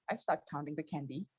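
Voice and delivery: normal talking voice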